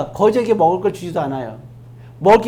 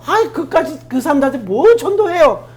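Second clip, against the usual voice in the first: higher-pitched, indignant objection; protesting Jonah; 7 semitones higher, 4 dB louder than usual. Acted. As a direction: push back as if the order is absurd. 하나님께 따지는 요나 흉내. (요나가 따지듯 높은 소리로)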